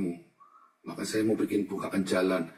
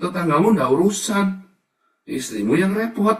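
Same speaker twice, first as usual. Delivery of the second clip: loud, much higher, fiery sermon delivery; preaching at full force; 7 semitones higher, 7 dB louder than usual. Acted. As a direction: warn the flock with fire. (khotbah lantang penuh semangat)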